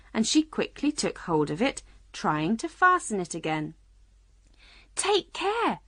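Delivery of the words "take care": high, urgent warning; crying out. A narrator's reading. Alice warning the Queen about the pin, urgent. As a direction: call out a quick caution. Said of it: "cried Alice".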